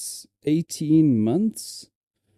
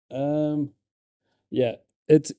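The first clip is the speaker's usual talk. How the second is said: ordinary talk, nothing marked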